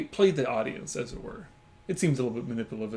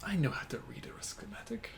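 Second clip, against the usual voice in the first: high, hushed imitation; whispered film quote; 6 semitones higher, 11 dB quieter than usual. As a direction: echo the movie line in a whisper. Mimicking the film's line.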